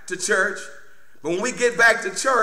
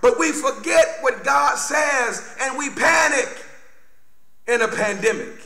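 full-force preaching; 8 semitones higher than usual. Real, not acted. Preacher warning church about forgetting God's word, emphatic preaching. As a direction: preach it out with raised pitch.

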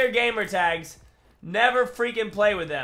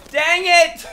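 loud, higher, laughing through it; frustrated shout; 7 semitones higher, 7 dB louder than usual. Real, not acted. Gamer dying mid-match, loud laughing shout. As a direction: yell it out as you die.

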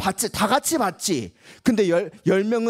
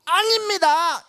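high, emphatic no; firm denial; 7 semitones higher, slower than usual. Shown as a demonstration. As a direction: declare it boldly. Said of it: "한번 해볼까요". (힘있게 "아닙니다")

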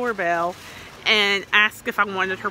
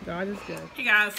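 ordinary delivery

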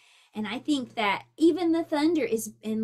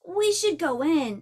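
higher, louder worried shout; 4 semitones higher, 6 dB louder than usual. Acted. Franklin urging his friends inside, worried shout. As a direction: call out urgently to friends. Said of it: "shouted Franklin".

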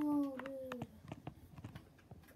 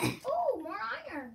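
high, bright thrilled squeal; excited; 7 semitones higher, 5 dB louder, slower than usual. Real, not acted. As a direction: squeal at the new find. Finds more iron.

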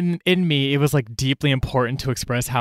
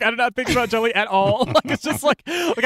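amused; 8 semitones higher than usual. Real, not acted. Laughing at past worries.

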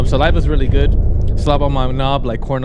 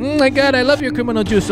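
much higher swooning voice; mock swooning; 12 semitones higher than usual. Gamer voices someone loving criminal juice. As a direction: coo it like you're blissed out. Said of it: "You're gonna go".

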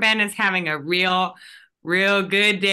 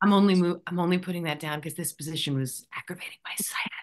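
rasped, whispered and fast; gruff low rasp, rushed out; imitating a gravelly tough guy excuse; 3 semitones lower, 7 dB quieter, much faster than usual. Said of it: "in, like, a kinda gravelly voice".

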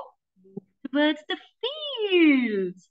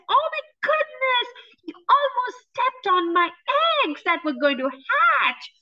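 high, quick flustered hen; fussy hen; 6 semitones higher than usual. Acted. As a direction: squawk it like a fussing hen. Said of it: "and she said".

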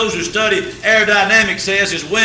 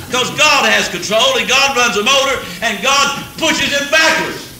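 rising, louder preaching build; preaching at full force, louder and higher; 4 semitones higher, 5 dB louder than usual. Acted. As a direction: drive it home like a revival preacher.

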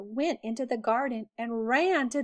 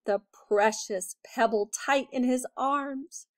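plain reading voice

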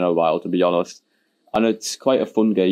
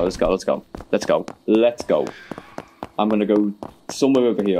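ordinary delivery

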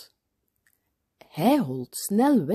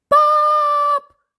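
very high, loud drawn-out scream for dad; panicked child's scream; 9 dB louder than usual. A narrator's reading. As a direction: scream "Paap" in alarm, long and high. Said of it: "Gilt Job".

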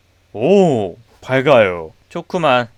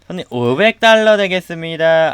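polite clerk voice. Pretend. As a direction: stretch 되겠습니다 like a sugary shop clerk. Repeats in clerk voice.

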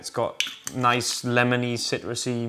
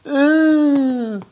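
high squeal; 9 dB louder than usual. Pretend. Hanging upside down, exclaiming.

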